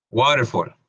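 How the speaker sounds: Waterfall with an O-like a in fall; English word demo. Shown as a demonstration. Teacher models the word for students. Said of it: "Es como el sonido de una O".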